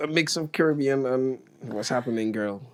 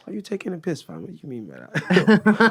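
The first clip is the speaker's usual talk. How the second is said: higher-pitched, playful British accent; mock-indignant British accent; British accent attempt; 6 semitones higher, 5 dB louder than usual. Shown as a demonstration. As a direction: challenge them in put-on British slang delivery. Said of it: "say them in your best British accent".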